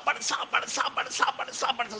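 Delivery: whispered repeated barsa; hushed whisper. Acted. Preacher hushes the crowd as rain falls. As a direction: breathe the rain in softly.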